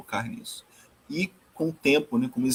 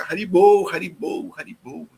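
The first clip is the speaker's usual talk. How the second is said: imitated street chant, starting raised and loud, then fading; calling out Haribol; 5 semitones higher, 5 dB louder than usual. Speaker imitates Chaitanya calling through the streets, chanting out then fading. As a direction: call it out repeatedly, letting it die away. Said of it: "nas ruas ele fica".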